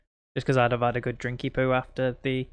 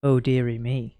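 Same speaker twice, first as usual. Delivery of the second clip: seductive voice; 3 dB louder than usual. Shown as a demonstration. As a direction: say it like the drink talking, seductive. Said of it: "it would be a much more seductive voice".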